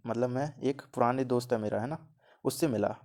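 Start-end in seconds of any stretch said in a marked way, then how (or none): none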